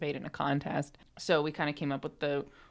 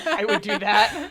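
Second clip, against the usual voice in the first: laughing, louder, slow; laughing admission; 5 semitones higher, 7 dB louder than usual. Real, not acted. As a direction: giggle through the confession. Admitting she'd sleep to it.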